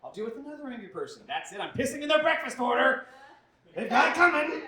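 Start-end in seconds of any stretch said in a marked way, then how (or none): 1.3-4.6 high, loud furious outburst; snapping in rage. Retold or imitated. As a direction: finally lose it and shout. Optimism snaps into rage.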